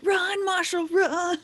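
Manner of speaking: high, loud urging; excited shout urging the rat to run; urgent cheering. Pretend. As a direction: yell at the rat to flee.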